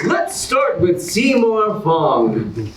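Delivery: big, bright host announcement; game show host. Acted. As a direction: sell it like a TV host to the audience.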